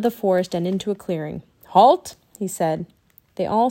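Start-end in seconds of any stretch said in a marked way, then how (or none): 1.7-2.2 loud, higher one-word command; commanding call. A narrator's reading. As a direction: call out an order to stop. Teddy commands the group to stop.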